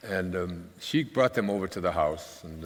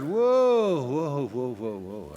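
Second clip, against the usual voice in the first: calming whoa; 7 semitones higher, 4 dB louder, much slower than usual. Retold or imitated. Calming the tattling girl.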